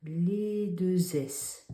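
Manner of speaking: les deux S said very slowly, the S drawn out; teacher insisting on the double S; stress on deux S. Shown as a demonstration. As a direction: stretch out deux S to make the point. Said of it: "on met bien".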